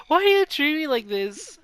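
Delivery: high put-on voice, hurt and pleading; whiny complaint. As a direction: whine it like a wounded pet. Character complains of mistreatment.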